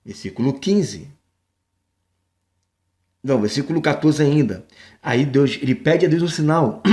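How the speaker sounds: ordinary talk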